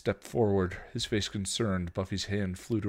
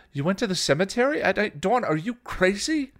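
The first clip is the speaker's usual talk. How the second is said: high, loud, fast disbelief; shrill-pitched outraged questioning; appalled scolding; 14 semitones higher, 9 dB louder than usual. A narrator's reading. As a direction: berate a sister in alarm. Shocked older sister scolds.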